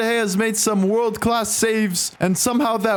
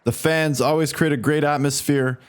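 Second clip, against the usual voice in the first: usual speaking voice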